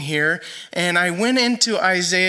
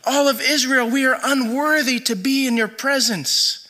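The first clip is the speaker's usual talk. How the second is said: restrained, higher-pitched confession; crying out to God; 6 semitones higher than usual. Retold or imitated. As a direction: plead upward to God without shouting. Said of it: "begins crying out to God".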